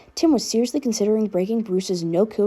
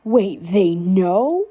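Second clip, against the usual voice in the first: slow, stretched-out shocked cry; shocked screech; 4 dB louder, much slower than usual. A narrator's reading. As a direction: drag it out in disbelief. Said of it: "Danny screeched".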